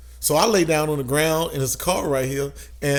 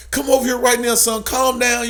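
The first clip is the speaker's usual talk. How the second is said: raised, high cop's command; loud, high-pitched imitation of police orders; imitated cop; 8 semitones higher, 5 dB louder than usual. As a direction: order a kid over like a police officer. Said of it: "I hear the cop like".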